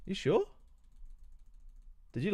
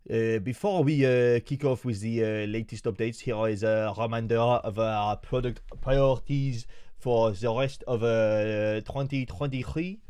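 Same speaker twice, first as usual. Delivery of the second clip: a light mock French accent, slow and a bit louder; 3 dB louder, slower than usual. Streamer reading the update aloud in a mock French accent. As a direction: read it with a jokey French accent. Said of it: "should we do it in a French accent".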